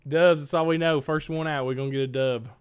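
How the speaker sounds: loud, fired-up hype; hyped gamer bro. Real, not acted. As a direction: pump up the squad, loud. Hyping teammate before match.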